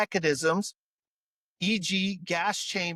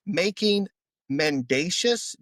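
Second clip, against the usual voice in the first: normal talking voice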